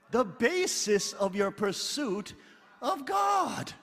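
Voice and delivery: starts slow, then cries out God high and loud; fervent shouted repeat. Real, not acted. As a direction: build the line to a shouted God. Repeats quote, rising to shout.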